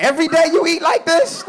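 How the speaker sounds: high, incredulous question; shocked dad. Retold or imitated. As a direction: act stunned at how much he eats. Dad shocked at son's eating.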